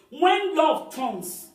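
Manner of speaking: slow solemn stress; weighty emphasis. Real, not acted. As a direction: lean into it slowly and gravely. Sermon climax line.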